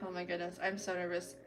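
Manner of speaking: ordinary talk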